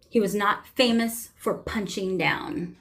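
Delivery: ordinary talk, nothing marked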